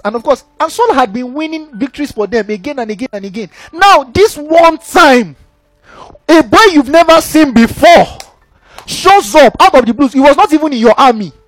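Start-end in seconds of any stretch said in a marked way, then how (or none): 3.7-11.3 slow, loud, higher preaching build; forceful preaching. Acted. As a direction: hammer each phrase out slowly to the crowd.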